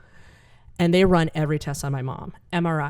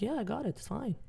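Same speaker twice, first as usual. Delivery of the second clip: soft dad; 4 semitones higher, 14 dB quieter than usual. Retold or imitated. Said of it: "He's like".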